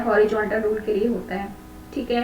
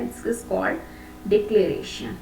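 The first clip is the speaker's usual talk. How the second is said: slow dictation; 4 semitones lower, 4 dB quieter, much slower than usual. Shown as a demonstration. Teacher dictating a definition.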